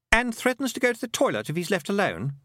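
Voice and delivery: put-on high-pitched young boy's voice; boy character, taunting. A narrator's reading. Roland complains about the girl's brother, high boyish voice. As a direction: play a cheeky boy, pitched well up.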